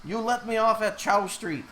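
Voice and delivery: high-pitched mock old lady demanding her stop; imitating insistent passenger. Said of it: "She kept saying over and over".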